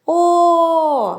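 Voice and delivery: О held long and high, like singing; співучий звук О. Shown as a demonstration. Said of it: "ми співаємо за допомогою голосу". (протяжне, наспівне «О» високим голосом)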